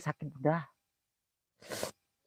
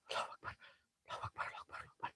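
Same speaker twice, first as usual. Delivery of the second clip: breathy frightened whisper; whispered prayer; 24 dB quieter, much faster than usual. Real, not acted. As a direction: hush the takbir under your breath. Scared ghost hunt, whispered takbir.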